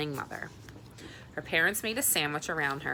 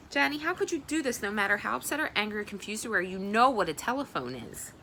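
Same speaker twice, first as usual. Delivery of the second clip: mother's voice, higher and faster; a worried mother scolding her daughter; mother demanding an explanation for the late return; 5 semitones higher than usual. Acted.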